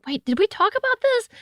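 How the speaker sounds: confused self. Retold or imitated. Host recalling her own confusion, high and puzzled. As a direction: squeak it out like you just realised. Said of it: "I'm like".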